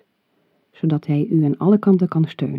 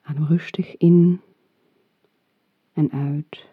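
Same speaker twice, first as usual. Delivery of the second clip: right in your ear. Acted. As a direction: whisper it close, like a breath. Whispered breathing cue.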